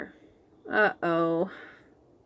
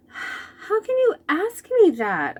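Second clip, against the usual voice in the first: high, loud indignant cry; indignant outburst; 11 semitones higher, 4 dB louder than usual. Acted. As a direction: cry out, stung that he doubts you. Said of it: "I say".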